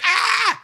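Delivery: very high hammed-up shriek of panic; comic panicked yelp; mock panicked scream. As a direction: let out a cartoonish terrified scream. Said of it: "And he's like".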